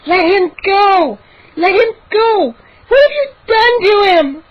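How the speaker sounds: very high, frantic shriek. A narrator's reading. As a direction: scream in horror for a friend's life. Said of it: "I heard Mindy shriek".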